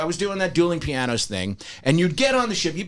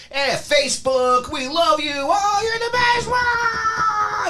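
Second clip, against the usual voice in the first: mock crowd cheering; much slower than usual. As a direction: ham up the adoring crowd. Crowd cheering the performer on ship.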